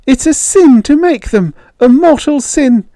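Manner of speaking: very high, loud frantic warning; hysterical protest. A narrator's reading. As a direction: protest desperately at a high pitch. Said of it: "You're hysterical".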